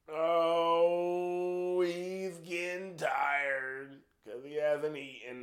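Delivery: high, drawn-out cooing voice; mock sympathy. Podcaster mocking his own tiredness, high drawn-out mock sympathy. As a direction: coo it slowly, stretching the words.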